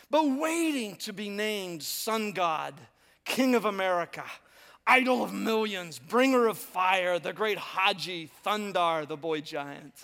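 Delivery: slow, stretched-out titles with weight; grandiose proclamation. Acted. Preacher reading secret fantasies of glory, grandiose and slow. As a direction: roll out each title like a royal herald.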